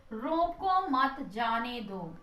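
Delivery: रोको मत जाने दो read slowly with no comma; drawn-out, evenly spaced example sentence; slow sentence readout. Shown as a demonstration. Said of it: "एक sentence लिखा हुआ है".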